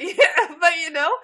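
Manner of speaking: laughing through the words; giggling while talking. Real, not acted. Laughing at own joke.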